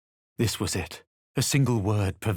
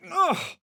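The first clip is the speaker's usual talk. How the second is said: pained grunt. Acted. Pain jolt from the tadpole.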